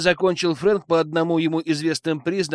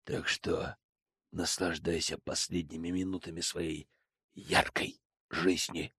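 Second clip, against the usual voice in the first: menacing low voice; 10 semitones lower, 11 dB quieter than usual. Acted. Frank threatens the bandit.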